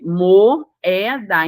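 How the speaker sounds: "moeda" drawn out as mo, e, da; slow "moeda" with a small pause between o and e; syllable by syllable. Shown as a demonstration. Teacher lets students hear both vowels, "moeda" syllable by syllable. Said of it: "ocorrendo uma pequena pausa".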